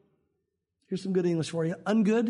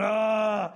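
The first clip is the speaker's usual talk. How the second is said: frustrated groan. Retold or imitated.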